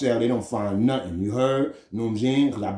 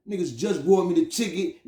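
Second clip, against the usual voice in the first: usual speaking voice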